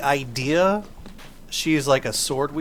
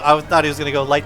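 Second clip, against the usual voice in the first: usual speaking voice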